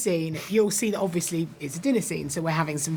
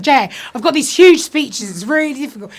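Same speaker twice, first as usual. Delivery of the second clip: exasperated protest; 7 semitones higher, 11 dB louder than usual. Retold or imitated. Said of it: "But I was like".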